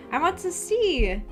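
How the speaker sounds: ordinary delivery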